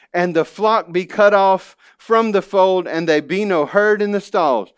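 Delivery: a preacher reading Habakkuk aloud to his church, with rising fervour; preaching scripture. Acted. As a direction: proclaim the verses to the congregation. Reads Habakkuk with preaching force.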